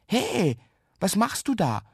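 high-pitched indignant challenge; scolding. A narrator's reading. Boy challenges a little intruder, scolding. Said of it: "schimpft Jan Ole".

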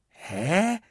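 high, rising puzzled grunt. Acted. Children wonder at the plastic cups, puzzled. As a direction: let it rise high in bafflement. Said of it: "wunderten sich alle im Chor".

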